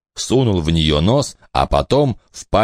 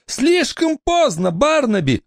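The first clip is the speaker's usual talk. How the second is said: mother calling. Acted. Mother calls after.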